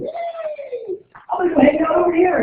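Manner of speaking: high, fast and gleeful, like a tiny excited atom; a bouncy cartoon particle cheering as it runs off; cheery atom voice. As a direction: squeal it out happily as the atom leaving.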